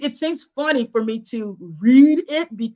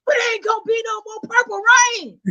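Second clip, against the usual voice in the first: high, loud, mournful protest, imitated; grandmother lamenting; 13 semitones higher, 6 dB louder, faster than usual. Grandmother grieving a singer, hammed-up lament. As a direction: imitate grandma bewailing her loss. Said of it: "but do the voices".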